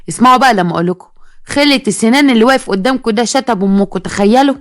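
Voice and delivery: plain reading voice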